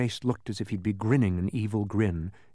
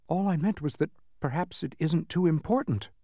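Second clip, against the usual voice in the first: higher, careful backpedalling; trying to be polite; 6 semitones higher than usual. A narrator's reading. Milo explaining himself to the angry man, carefully polite. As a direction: tread politely around the disagreement. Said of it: "trying not to be impolite".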